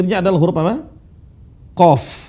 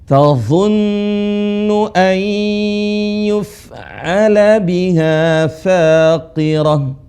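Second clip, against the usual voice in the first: slow, melodic tajwid chant; Quran recitation; 2 semitones higher, much slower than usual. Shown as a demonstration. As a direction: recite the example slowly, drawing out vowels. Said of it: "Silakan praktekkan". (tilawah contoh dengan panjang)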